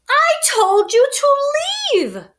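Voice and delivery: loud, high cry of frustration; angry cry. A narrator's reading. Bear yelling at the intruding mouse, loud and angry. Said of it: "cried Bear".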